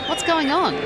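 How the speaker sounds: puzzled wondering. A narrator's reading. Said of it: "Sue wondered".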